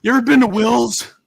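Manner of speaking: mocking Mikey. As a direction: do a teasing, hammed-up impression of your buddy. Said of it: "making fun of Mikey voice".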